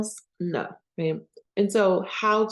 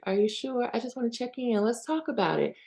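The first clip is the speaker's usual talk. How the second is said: higher-pitched, coaxing pressure; controlling check-in; 5 semitones higher than usual. Acted. Afraid of being alone, pushing someone to talk, controlling check-in. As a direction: press gently in a raised, wheedling pitch. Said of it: "controlling behaviors of like".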